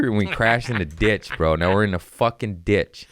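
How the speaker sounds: ordinary talk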